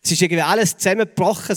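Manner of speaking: ordinary delivery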